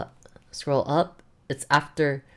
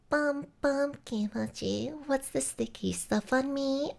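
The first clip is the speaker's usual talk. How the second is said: put-on high, cutesy anime girl voice; squeaky cartoon girl line; 12 semitones higher than usual. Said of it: "I love it".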